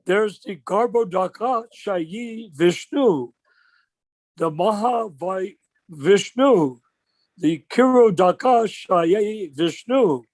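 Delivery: very slow, deliberate listing of the names; slow pointing recital. Retold or imitated. Senior godbrother pointing at the picture, reciting slowly. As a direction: spell out each Vishnu like a schoolteacher. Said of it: "That's how he said it".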